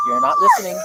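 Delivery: high squeak. Real, not acted. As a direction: voice jumps way up high. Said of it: "she hit that [name] note".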